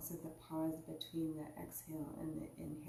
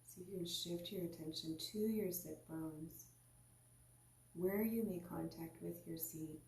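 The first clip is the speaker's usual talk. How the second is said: guided meditation. Acted. Teacher guiding listeners into their seat, calm meditation voice. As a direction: ease the listener inward, unhurried.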